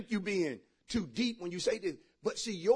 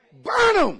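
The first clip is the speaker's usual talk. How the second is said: shouted, much higher burn command; loud command; 13 semitones higher, 10 dB louder than usual. Acted. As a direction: bark it at the congregation. Preacher commands loudly.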